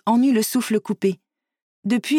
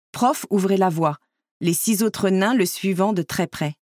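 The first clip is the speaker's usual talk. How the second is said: ordinary delivery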